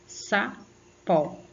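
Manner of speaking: "Sapó" split into sa, pó with a gap; two separate syllables. Shown as a demonstration. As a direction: sound out "Sapó" in two spaced beats. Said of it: "Você abriu a boca duas vezes". ("Sapó" sílaba por sílaba: sa-pó)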